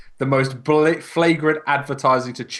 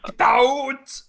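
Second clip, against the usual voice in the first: mock Arnold shout; 11 semitones higher than usual. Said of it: "quoting Arnold line".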